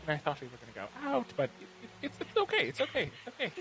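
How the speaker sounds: high, wounded little voice trying to sound okay; hurt, pretending he's fine. Jilted cook to his date, high wounded pretend-fine voice. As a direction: squeak out that it's fine while clearly hurt. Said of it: "you see his eyes start to tear up".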